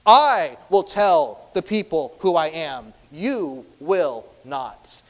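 slow, loud, word-by-word command; stern rebuke. Acted. Jesus silencing the demon, forceful slow rebuke. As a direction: lay down each word with firm authority. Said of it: "It says that Jesus rebukes him".

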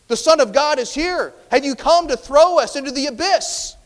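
high, loud panicked cry; hammered-out high shout of alarm; terrified demons. Acted. Demons confronting Christ on his arrival, acted terrified shout. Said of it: "the demons are terrified".

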